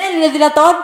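loud, high mock outrage; escalated incredulous protest; indignant outburst. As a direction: cry out in exaggerated disbelief.

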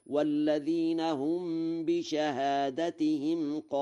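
long-held recitation, short second vowel in بشهادتهم; singular reading, recited. Shown as a demonstration. Teacher demonstrates the whole verse, بشهادتهم in singular. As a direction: chant it with بشهادتهم as bi-shahaadatihim. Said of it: "فيقرأ هكذا".

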